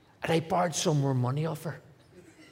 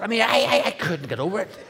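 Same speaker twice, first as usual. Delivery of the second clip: laughing; 9 semitones higher, 7 dB louder than usual. Real, not acted. Laughing at loving Thatcher.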